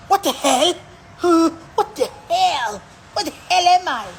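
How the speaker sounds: mock-dramatic soapie waking; Generations guy. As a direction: wake up bewildered like a soap actor. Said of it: "njengaloyo bhuti wase-Generations".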